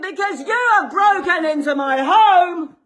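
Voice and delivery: high, loud, outraged yell; real furious full-voiced shout; shouting in someone's ear. Real, not acted. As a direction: bellow the accusation right at them. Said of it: "No need to shout in my ear".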